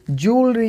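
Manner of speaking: ज्वेलरी as "JOOL-ree"; correct pronunciation. Shown as a demonstration. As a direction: say ज्वेलरी in two syllables, long "oo". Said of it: "इसकी सही तरीके से pronunciation होगी". (ज्वेलरी को 'जूलरी' बोलें)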